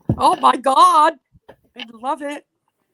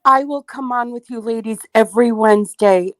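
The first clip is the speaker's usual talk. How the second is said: usual speaking voice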